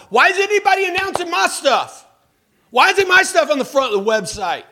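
loud, high, whiny demands; attitude. Retold or imitated. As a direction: act the entitled staffer complaining. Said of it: "getting a little bit of an attitude like".

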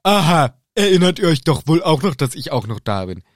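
a touch of loud huffiness; sulky, offended Ron. Acted. Ron sulking at being forgotten, acted huffy. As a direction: pipe up hurt, falling away at the end. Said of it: "Ron liegt stinksauer im Bett".

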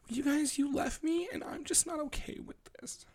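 high, soft, whiny pet voice; whiny cat voice. Pretend. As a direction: play the sulking cat, high and fading. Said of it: "She's like".

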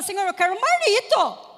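mock childish plea; pleading little girl. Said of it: "Parecia aquela menininha que chora".

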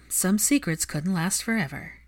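ordinary delivery, nothing marked

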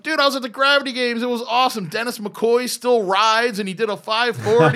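high, gushing enthusiasm; retold excitement. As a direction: gush to a buddy about the weekend. Retells gushing to a surfer friend.

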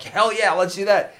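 imitating an instant eager agreement; mock eager yes. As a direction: play a friend jumping at the idea. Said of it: "instantly jump up and be like".

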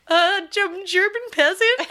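high, loud, halting claim; nervous bluff. Acted. Caught French spy fakes being a German peasant, nervous bluff. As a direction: blurt it out high and unconvincingly.

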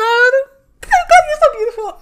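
high, loud and fast; overwhelmed, gushing compliment. Real, not acted. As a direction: gush with giddy delight. Gushing at the listener.

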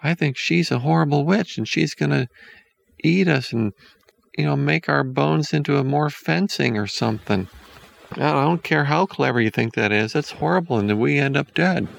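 put-on high, worried child's voice; scared boy voice. A narrator's reading. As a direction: fret like a frightened little boy. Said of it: "And Hansel says".

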